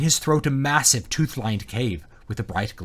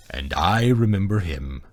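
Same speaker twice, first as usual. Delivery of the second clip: slow dragon voice; ponderous, deep beast; deep booming dragon; 3 semitones lower, slower than usual. A narrator's reading. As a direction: speak slowly as an ancient dragon. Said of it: "booming and deep".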